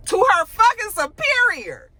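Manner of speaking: high, slow, stunned outrage; outraged. Real, not acted. Host outraged for Jax, slow high disbelief. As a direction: spell out the disrespect in disbelief.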